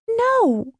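put-on very high-pitched girl's no; distressed refusal. A narrator's reading. Daughter refuses mother's order.

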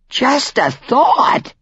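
high, slow disbelieving question; incredulous echo. Acted. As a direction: repeat it back in disbelief. Chicken echoes shocking news.

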